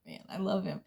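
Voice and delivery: ordinary talk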